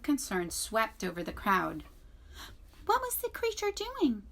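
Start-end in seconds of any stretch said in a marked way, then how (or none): 2.9-4.2 much higher squeaky weasel. A narrator's reading. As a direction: squeak it out nervously. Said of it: "said Dart the Weasel".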